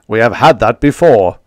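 loud irritated retort; indignant. Acted. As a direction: snap back indignantly. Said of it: "the bellman indignantly said".

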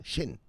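"Xin" said "shin". Shown as a demonstration. Showing viewers the X, "Xin" said as shin. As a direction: start "Xin" with sh, softly. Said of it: "That's how you pronounce an X".

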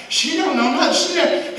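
high, loud amazed friend; mock friend's voice. Her friend insisting to the preacher, amazed and loud. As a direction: play the astonished friend up high. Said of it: "He was her friend".

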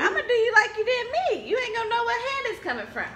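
playful threat. Real, not acted. Threatening revenge slap, laughing.

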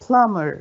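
"plumber" as PLUM-ber, falling; stress on first syllable. Shown as a demonstration. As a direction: punch PLUM in "plumber", let the rest drop. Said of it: "يبقى على أول مقطع".

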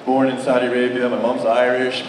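ordinary talk, nothing marked

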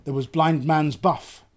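plain reading voice

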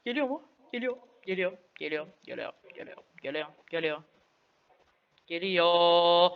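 ordinary talk, nothing marked

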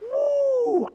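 high woo, mock delight; excited whoop. Preacher recalls his first salary, high mock whoop. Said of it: "I was like".

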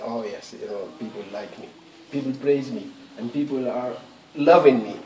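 low, soft mock self-congratulation; self-satisfied. Preacher imitating someone swollen with praise, quietly self-satisfied. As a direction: murmur it low, as if privately pleased with yourself. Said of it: "your head swells with pride".

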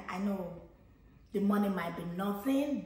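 ordinary delivery

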